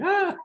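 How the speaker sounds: high mock shriek of fear; imitating an agoraphobic's panic; mock scream. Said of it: "It's like".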